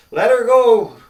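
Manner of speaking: high, loud cowboy holler; yelling. Acted. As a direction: holler it across the pen. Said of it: "he yelled up again".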